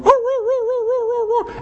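very high, repeated falsetto yelps; imitated dog yelping. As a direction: mimic a dog yelping as it flees. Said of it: "He took off running, going".

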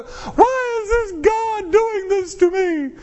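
high whiny sob imitating a hurting congregant; pleading through fake tears; mock crying complaint. Preacher imitating a crying member in his office, whiny sobbing.

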